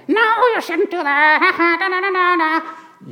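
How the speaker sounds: very high, loud squeaky taunting; yelling teddy bear puppet. Acted. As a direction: yell as a naughty puppet, ending in nonsense. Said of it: "he's yelling at me".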